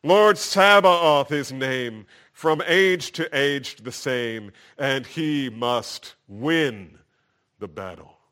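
high, loud, slow declaration; "Lord" held long, high and loud, then slow build to "he must win"; triumphant proclamation. Shown as a demonstration. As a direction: declare God's victory line by line. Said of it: "let me just read some of the lyrics to you".

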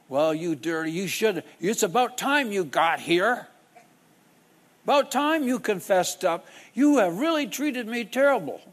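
put-on scornful older man, high and loud; indignant scolding. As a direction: play the offended man who finally gets his apology. Offended man scolds apologizer.

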